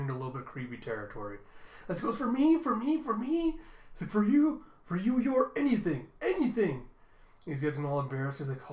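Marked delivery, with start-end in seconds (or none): 2.2-3.6 high, gushing repetition; hammed-up giddy outburst; overexcited. Acted excited echo of a plea. 4.1-6.9 loud, high, giddy promise; hammed-up fawning outburst; gushing devotion. Acted gushing devotion to sister.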